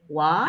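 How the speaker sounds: "What?" with a sharp rise; rising what. Shown as a demonstration. Teacher acting a surprised patient. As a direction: say "What?" rising like asking a question. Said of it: "It's like asking a question".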